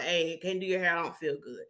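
low, soft apology; subdued, under-the-weather voice; feeling sick. Retold or imitated. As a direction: beg off quietly, feeling unwell. Sick stylist begging off gently.

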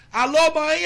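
calling out loud into a payphone; loud phone hello. Retold or imitated. Son shouting hello to his mother from a German payphone, loud. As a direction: shout hello to mum down a bad line. Retold phone call cut off.

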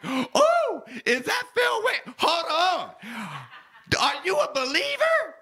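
light up at a song you love; excited shout. Retold or imitated. Asking the Uber driver if she's a believer, high thrilled shout. Hearing [name] in the Uber.

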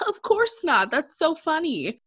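high, amused villain laugh-talk; mock laughing chairman. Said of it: "the chairman laughs and is like".

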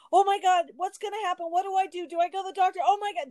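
mock panic. Recalling her reaction when the dog hit her shin, in mock panic. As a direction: replay the alarmed what-do-I-do voice. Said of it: "panic, the pushing against".

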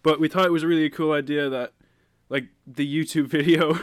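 ordinary delivery

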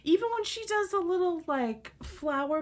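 mock outrage. Real, not acted.

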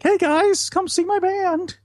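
high-pitched mocking teen voice; mock excited kid. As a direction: squeal it like an eager kid. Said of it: "you're like".

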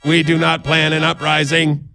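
monotone robot voice, pitch held level; robot impersonation. Pretend. Podcaster showing off a robot impersonation for listeners, monotone robot voice. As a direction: intone it evenly like a machine. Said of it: "That's my robot impersonation".

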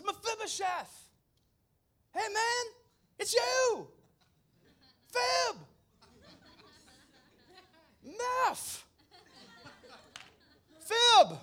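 very high, loud mock-excited yell; over-the-top, high-pitched delighted cry; excited greeting. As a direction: hail a long-lost pal in a squealing shout. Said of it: "David is excited, the king".

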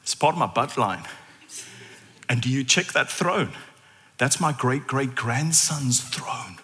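soft, low, chatty boast; lightly voiced lady. Naomi quietly boasting to a fellow worshipper. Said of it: "And she'll go".

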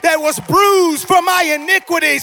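impassioned pulpit crescendo; fervent, mounting sermon cry; full-force preaching. Real, not acted. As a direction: whip the congregation up into praise.

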